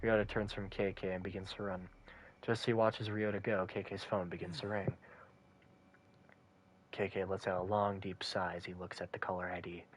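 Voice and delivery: low, soft storytelling; story narration. A narrator's reading. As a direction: narrate it quietly in a low register. Reads visual novel narration.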